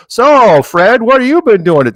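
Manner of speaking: loud, high, hearty small-talk question; mock dinner-table relative. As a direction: call it across the dinner table, hammed up. Acted relative at Thanksgiving dinner table.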